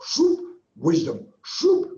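squirt out a quick shoop; eyedropper shoop. Retold or imitated. God mimics an eyedropper.

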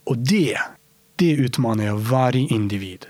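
ordinary delivery

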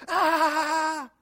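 high scream, then slow, drawn-out question; panicked scream. Acted. Preacher imagining us in the boat, panicked scream. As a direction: act out high-pitched alarm, stretching the words. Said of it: "we'd be like".

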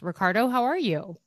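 ordinary talk

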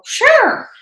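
a very high, loud yes; eager sure. Retold or imitated. Telling her friend yes to the coupon, squealing high. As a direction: jump at the offer. Agreeing to the Groupon visit.

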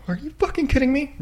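high, stunned disbelief; can't believe what you just saw; incredulous disbelief. Real, not acted. Reacting to the surprise Cloverfield reveal.

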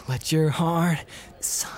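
whispered, airy and slow; breathy whisper. Retold or imitated. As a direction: breathe the line out softly. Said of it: "too breathy".

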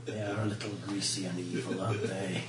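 low, sly evil dragon; deep dragon voice. Acted. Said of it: "Notice how the dragon's voice got very deep".

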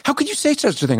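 high, mock-outraged gasp of a question; mock shock. Retelling the woman's shocked reply to his accusation, high mock outrage. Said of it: "She went".

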